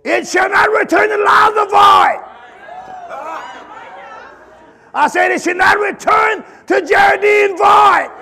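loud, high preacher's cry; preaching at full force. Acted. Preacher urging the church, at full force. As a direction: drive the exhortation home like a pulpit call.

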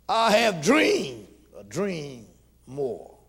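loud, slow scripture preaching. Acted. Preacher proclaiming Joseph's dream, loud and slow.

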